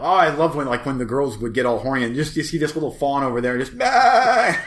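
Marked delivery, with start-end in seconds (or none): 3.8-4.6 sudden high yelp; excited yelp. Retold or imitated. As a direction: squeal it out like the fawn. Imitating fawn's excited cry.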